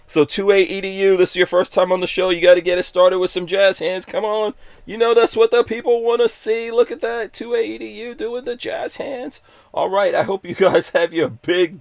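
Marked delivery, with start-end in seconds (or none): 0.1-9.4 high, loud hype for the jazz hands; hyped-up host. Real, not acted. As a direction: pump the crowd up like a showman. Hyping the guest's jazz hands.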